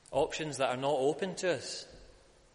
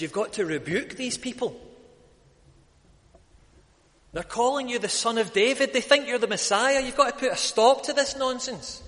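higher and louder, demanding Jesus rebuke the crowd; indignant scribes; 10 semitones higher, 7 dB louder than usual. Retold or imitated. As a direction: build an outraged protest toward the end. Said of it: "and they said".

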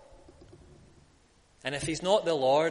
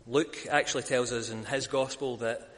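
usual speaking voice

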